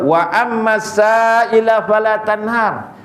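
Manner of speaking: slow, drawn-out Quranic chant; Quran recitation. Acted. Preacher recites the verse to the congregation, slow Quranic chant. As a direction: intone the verse slowly.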